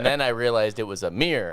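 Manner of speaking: ordinary delivery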